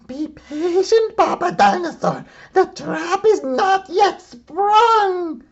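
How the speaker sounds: very high, loud monster mom, scheming; villain plotting in the woods; scheming Mama Dinosaur. Acted. Mama Dinosaur to Papa Dinosaur, scheming in a very high loud voice.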